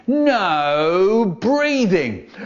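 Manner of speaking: very long drawn-out, high swooping command; strict teacher. Acted. Teacher standing out the front ordering the class, strict. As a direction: stretch each word like a tyrannical teacher. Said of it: "a teacher who was so strict".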